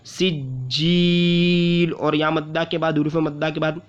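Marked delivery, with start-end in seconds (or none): 0.1-1.9 सिज्जील with a thin सीन and long जी; slow recited सिज्जील. Shown as a demonstration. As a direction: stretch the जी in सिज्जील. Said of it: "इसको भी खींचना है".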